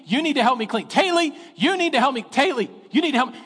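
put-on a boy pestering his sister over and over; imitating a son nagging his sister to help; kid nagging sister. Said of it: "Cade goes".

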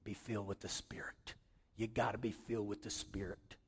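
put-on low, hushed Russian accent. Preacher quotes his Russian friend's advice, hushed Russian accent. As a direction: imitate a Russian friend, very soft and deep. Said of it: "You know what he'd tell me in that, that Russian accent?".

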